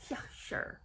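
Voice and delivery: barely voiced, throwaway brush-off; dismissive mock agreement. Host imitates her answering his request, dismissive and hushed. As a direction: imitate her brushing him off under the breath. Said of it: "And she'd be like".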